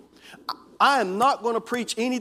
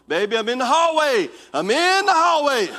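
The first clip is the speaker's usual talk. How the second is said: higher, louder call down a dark hallway; 7 semitones higher, 7 dB louder than usual. Pretend. Husband calls out to his wife in the dark hallway, loud and high. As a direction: call out to warn her you're there. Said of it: "And I'm gonna say".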